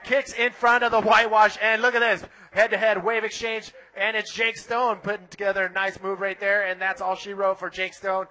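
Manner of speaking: loud, excited surf call over the action; live play-by-play. Acted. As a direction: call the ride live, building to the flip. Live surf heat commentary.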